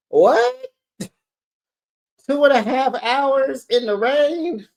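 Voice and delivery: high, loud, disbelieving question; incredulous. Acted. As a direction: balk at a ridiculous request. Balks at such a long drive.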